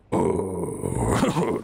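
deep growling warrior cry; low war cry of a flame warrior. Acted. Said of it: "that's my warrior voice".

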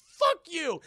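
high, loud insulted shout; angry outburst. Retold or imitated. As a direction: blow up at the cheeky kid. Scrooge snaps at nephew's insult.

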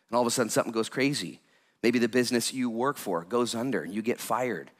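ordinary delivery

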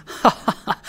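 acted hearty cackle of delight; joyful laugh. A narrator's reading. Father catching up the child, joyful laugh. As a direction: laugh out in separate merry bursts.

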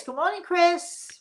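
high, sing-song good morning; cheery hello to the co-host; bright greeting. Real, not acted.

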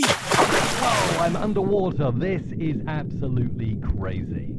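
alien-like wobble. Shown as a demonstration. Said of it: "Sounds a little bit like an alien".

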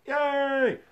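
a high, loud, gleeful cheer; excited yay. Retold or imitated. Going out to see the new year, an excited cheer. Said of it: "and I was like".

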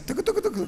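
very high, laughing clip-clop; playful hoof sounds; goat hooves. Retold or imitated. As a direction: mimic running goats' hooves. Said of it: "Σαν κατσίκια".

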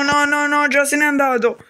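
ordinary talk

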